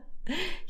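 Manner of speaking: short soft chuckle; quiet amused laugh rising in pitch. A narrator's reading. Khaled amused at Galila's plotting, soft laugh.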